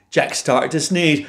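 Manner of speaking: plain reading voice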